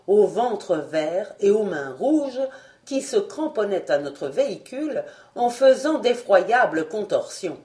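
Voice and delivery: ordinary delivery, nothing marked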